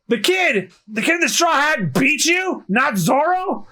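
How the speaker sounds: high-pitched, incredulous captain; shocked disbelief. Acted. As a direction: demand it in astonishment. Captain shocked by crewman's defeat report.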